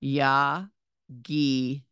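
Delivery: Yagidu drawn out slowly, ya-gi-du; slow model reading. Shown as a demonstration. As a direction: read Yagidu syllable by syllable. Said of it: "the final word in the fifth line is yagidu".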